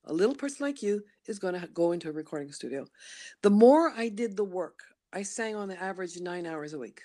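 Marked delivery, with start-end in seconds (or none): 0.0-2.9 brisk insistence. Retold or imitated. Inner voice insists back.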